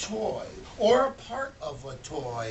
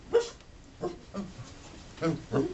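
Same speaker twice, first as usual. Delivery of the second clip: dog barking; much faster than usual. Acted. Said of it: "the dog went".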